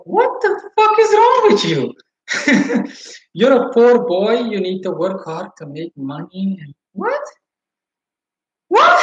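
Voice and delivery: high, laughing what the fuck; cracking up while calling him out; retold outburst broken by laughter; laughing disbelief. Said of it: "just made me laugh".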